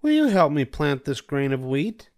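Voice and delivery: a hen politely asking a neighbour; Little Red Hen. A narrator's reading. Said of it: "She asked the cat".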